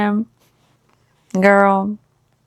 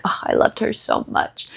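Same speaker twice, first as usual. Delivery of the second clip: whispered, softer, mock-tender; mock wistful whisper; 7 dB quieter than usual. Adam speaking of his wife, mock wistful whisper. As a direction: breathe it like a lovesick widower. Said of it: "is very much like".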